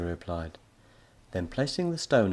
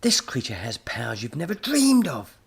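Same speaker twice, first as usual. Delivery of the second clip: higher, louder, gushing with excitement; the professor getting carried away; overexcited professor; 6 semitones higher, 6 dB louder than usual. Acted. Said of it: "getting overexcited".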